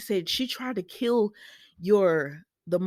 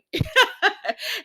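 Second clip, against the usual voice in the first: high loud laugh; real high laugh; 14 semitones higher, 6 dB louder than usual. Real, not acted. Vlogger laughing at Brooke giving in, high laugh. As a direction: crack up for real.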